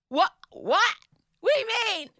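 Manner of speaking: disbelieving high-pitched what what; shocked. Retold or imitated. Mother confronting her daughter about the nachos, shocked and high.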